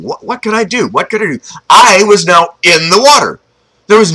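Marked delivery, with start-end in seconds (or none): 0.0-1.4 higher-pitched anxious self-questioning; retold panic, soft and high; panicked. Boy thinks frantically how to save them, retold panic. Panicked thoughts while stuck in river.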